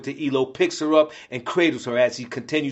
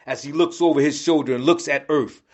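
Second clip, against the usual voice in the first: ordinary delivery, nothing marked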